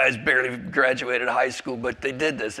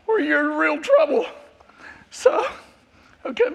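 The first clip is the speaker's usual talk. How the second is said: choked up; 11 semitones higher than usual. Real, not acted. [name] telling the church about Christ's love, voice breaking. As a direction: voice breaking and pitched way up with emotion. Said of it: "maybe it's out of my system".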